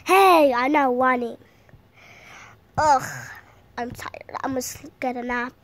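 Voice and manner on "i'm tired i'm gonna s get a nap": breathy whisper, almost falling asleep; sleepy whisper. Pretend. Tired child whispering before a nap.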